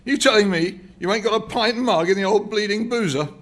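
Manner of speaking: put-on high, loud, cockney old man; pugnacious old man. A narrator's reading. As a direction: bristle at the barman like an indignant old prole. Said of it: "straightening his shoulders pugnaciously".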